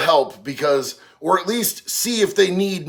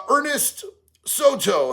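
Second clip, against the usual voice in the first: ordinary talk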